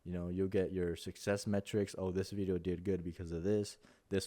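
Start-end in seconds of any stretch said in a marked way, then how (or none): none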